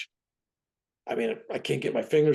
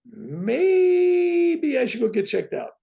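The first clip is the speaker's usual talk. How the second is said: higher, louder and slow, stretching maybe; drawn-out realization; 14 semitones higher, 6 dB louder, much slower than usual. Retold or imitated. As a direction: drag it out as the penny drops. Realizing he needs his heart checked.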